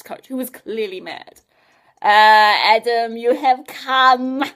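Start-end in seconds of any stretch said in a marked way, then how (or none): 2.1-4.4 slow, loud, leering villain greeting; drawn-out mad scientist welcome; nasty leer. Acted. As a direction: greet the hero with a gloating leer. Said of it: "with a nasty leer".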